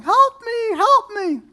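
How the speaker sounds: high, loud, long-held plea for help; mock cry for help. Neighbor hanging out of the burning window, crying help. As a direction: call from the burning window, panicked.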